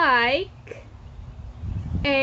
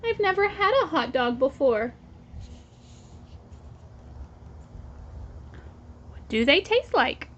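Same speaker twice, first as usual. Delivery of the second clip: put-on reading to children; 5 dB quieter than usual. Teacher reading the Pigeon book aloud to her class. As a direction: read it out for little ones, pausing for them to echo.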